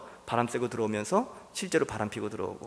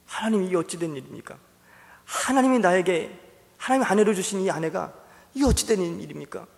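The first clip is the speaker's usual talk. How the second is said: mild complaining; 6 semitones higher than usual. Acted. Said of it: "투정하면서".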